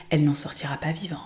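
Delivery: hushed, dramatic whisper; spooky whispered teaser ending; ominous whisper. Acted. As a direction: breathe the last threat. Ominous last line of blurb.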